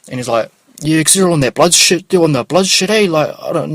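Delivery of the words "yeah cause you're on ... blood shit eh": high, loud and pushy; loud street stranger. Retold or imitated. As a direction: fire the question up high and loud. Stranger talks gang stuff.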